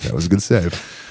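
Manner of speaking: slow, dry joking read; humorous. Shown as a demonstration. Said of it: "Make it humorous".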